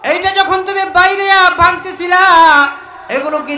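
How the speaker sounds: high, loud chanted questioning; full-force preaching. Acted. Allah questioning Noah in a high, loud preaching chant. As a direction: preach it out, ringing and stretched.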